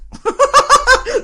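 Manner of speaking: high, loud burst of laughter. Real, not acted. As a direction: crack up loudly. Laughing at own joke.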